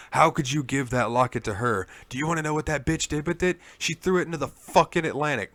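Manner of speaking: mock-furious rant at the player; retold screaming. Therese rants at the player over the locket, mock-furious. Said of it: "She starts screaming at you".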